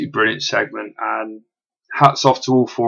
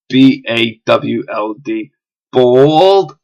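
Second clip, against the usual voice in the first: put-on awful New York accent; 7 dB louder, much slower than usual. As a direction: spell it slowly, then belt the word in a bad New York accent. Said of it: "that was my awful New York accent".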